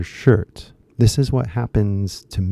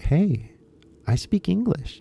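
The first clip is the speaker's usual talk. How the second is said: much higher-pitched mock boast; mock announcement; 9 semitones higher than usual. English learner letting people know, mock announcement. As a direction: pipe up as if advertising yourself. Acted announcing oneself to onlookers.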